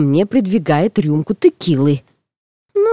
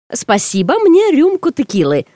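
high-pitched, reckless order; devil-may-care; 7 semitones higher than usual. A narrator's reading. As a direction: say it with carefree bravado. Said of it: "самым своим отвязным голосом произношу я".

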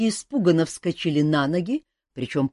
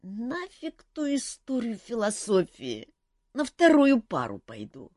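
put-on sleepy girl; 7 semitones higher than usual. A narrator's reading. Half-asleep Olga keeps mumbling.

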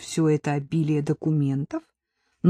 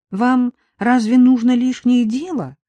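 higher, nagging plea; whining; 6 semitones higher, 4 dB louder than usual. Acted. Nadezhda keeps pestering the sergeant, whining plea. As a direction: keep wheedling him. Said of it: "продолжала ныть Надежда".

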